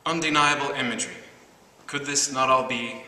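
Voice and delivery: measured, deliberate poem recital with pauses between phrases; projected, carefully articulated verse reading; poetry reading. Acted. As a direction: read the poem out to the room, line by line. Reads own poem aloud.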